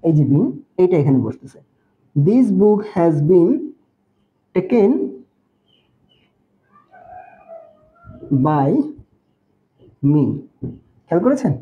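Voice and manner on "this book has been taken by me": slow dictation. Shown as a demonstration. Teacher dictating example sentence.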